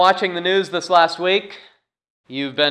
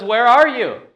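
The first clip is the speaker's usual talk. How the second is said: raised, louder call of God; 8 semitones higher, 7 dB louder than usual. Retold or imitated. God calling to Adam and Eve hiding, loud and high. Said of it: "And God comes, he says".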